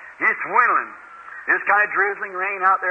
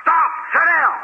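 sharp yell; 8 semitones higher than usual. Retold or imitated. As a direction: shout him down. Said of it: "Somebody said".

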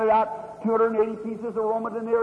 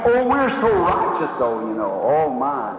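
mock pious voice; 8 semitones lower, 4 dB louder than usual. Preacher mocks self-righteous churchgoers.

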